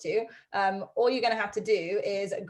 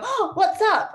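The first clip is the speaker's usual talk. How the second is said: high, loud, startled doggy voice; be a dog suddenly curious; high-pitched perky gasp of interest; voicing a surprised dog; 12 semitones higher, 7 dB louder, slower than usual. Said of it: "they're gonna go".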